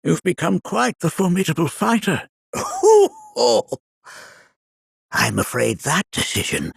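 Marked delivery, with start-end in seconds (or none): 2.5-3.1 loud high-pitched cackle. Acted. Old sage laughing at his own riddle, loud cackle.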